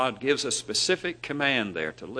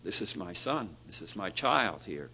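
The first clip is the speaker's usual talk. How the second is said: softer, tender murmur over a newborn; proud parent; 5 semitones lower, 8 dB quieter than usual. Retold or imitated. Parent in the nursery holding the baby up, soft and tender. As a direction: show off the baby gently. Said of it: "picture somebody in the nursery holding their baby up".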